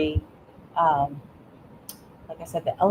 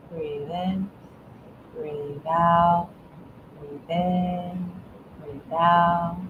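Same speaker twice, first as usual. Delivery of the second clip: guided breathing; much slower than usual. Shown as a demonstration. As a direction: lead a breathing exercise, drawing out each cue. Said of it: "teach kids to start at their thumb".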